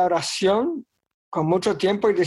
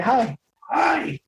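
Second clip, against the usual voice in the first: mock cry of dismay; 7 semitones higher than usual. Grito retomado de su papá.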